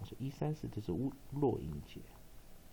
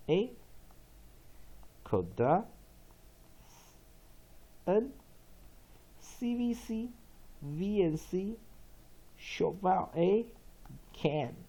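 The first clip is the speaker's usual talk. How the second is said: very slow, each letter drawn out; stretched classroom spelling-out; slow syllable analysis; 8 semitones higher, much slower than usual. Shown as a demonstration. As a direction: spell the syllable parts out slowly. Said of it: "这第一个音节有什么".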